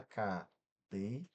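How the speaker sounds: cadeira broken into CA, DEI, RA with long gaps; split into syllables. Shown as a demonstration. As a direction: say cadeira one slow syllable at a time. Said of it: "separa a sílaba". ("cadeira" separado sílaba por sílaba)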